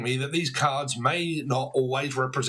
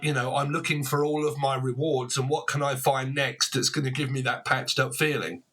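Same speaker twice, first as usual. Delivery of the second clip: ordinary talk, nothing marked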